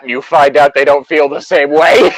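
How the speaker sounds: shouts the punchline, laughing; laughing yell. Real, not acted.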